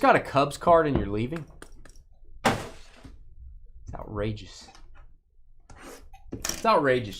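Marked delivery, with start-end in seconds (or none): 2.4-4.7 breathy whisper, slow and drawn out; whispered mock outrage. Host whispering mock outrage at a departing viewer. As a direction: hush it like a scandalous secret. Viewer leaving after Cubs card.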